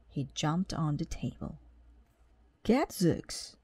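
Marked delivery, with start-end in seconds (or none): 2.6-3.6 walrus voice. A narrator's reading. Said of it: "cried the walrus".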